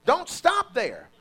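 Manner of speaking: "don't stop there" slow and loud, "stop" punched; stress on stop. Shown as a demonstration. Preacher modelling the line for the congregation, slow and loud. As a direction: lean hard on "stop" and draw it out. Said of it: "Look at somebody and say".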